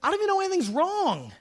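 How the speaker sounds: high, baffled protest; baffled husband. Retold or imitated. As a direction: protest that you had no idea. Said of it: "I'd be like".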